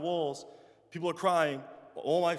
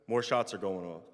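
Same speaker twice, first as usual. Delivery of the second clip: ordinary talk, nothing marked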